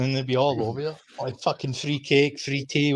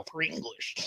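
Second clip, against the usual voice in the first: mock disgust; 3 semitones higher, 12 dB quieter than usual. Waiter telling the kitchen about English customers, mock disgust. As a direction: imitate a waiter muttering in distaste. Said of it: "like, proper disgust".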